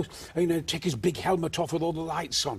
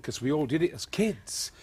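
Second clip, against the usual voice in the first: ordinary talk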